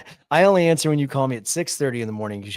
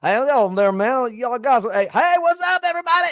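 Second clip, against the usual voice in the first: put-on higher, rising to a loud drunk holler; drunk party host; 10 semitones higher, 6 dB louder than usual. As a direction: greet the whole porch crowd at full cheer. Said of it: "you're like".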